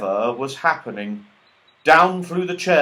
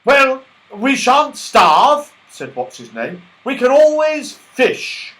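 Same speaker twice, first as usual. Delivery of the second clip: cheerful voice; 13 semitones higher, 9 dB louder, slower than usual. Acted. What's-His-Name consoling.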